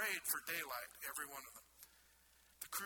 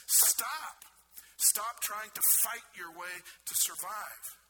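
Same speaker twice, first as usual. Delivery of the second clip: commanding stop; 4 semitones higher, 6 dB louder, slower than usual. Acted. Paul commanding the crew.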